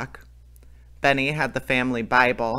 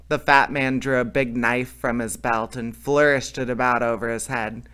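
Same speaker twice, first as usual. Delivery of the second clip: plain reading voice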